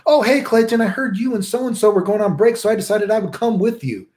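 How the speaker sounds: mock-innocent kid barging in; chirpy Church Boy. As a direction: sound oblivious and eager. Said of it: "be like".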